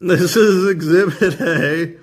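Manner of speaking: laughing through the words; chuckling as he presents. Real, not acted.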